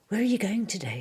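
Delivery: a hint of the bear asking cheerfully. Pooh asking the boy where he's going, lightly voiced.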